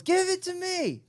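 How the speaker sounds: high, slow, hushed lady's demand; lightly put-on high voice of a pushy woman.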